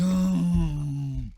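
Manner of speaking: loud, high, dopey yeti "Oh"; a big sad yeti lowing "Oh"; yeti groan. Acted. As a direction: bellow it like a sad, dim yeti. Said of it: "He goes".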